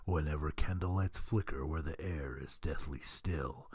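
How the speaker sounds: deep, slow ghostly narrator; low and slow haunted-house host; slow theatrical villain menace. Acted. Spooky haunted-house narration intro.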